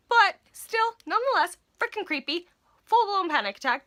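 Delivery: high, loud punched summary; emphatic. Real, not acted. Storyteller insisting it was creepy despite everything, loud and emphatic. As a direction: hit each phrase hard and high.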